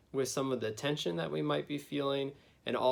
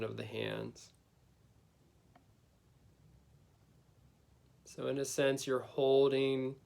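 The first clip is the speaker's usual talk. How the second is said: guided meditation. Acted. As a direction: gently lead them inward, unhurried.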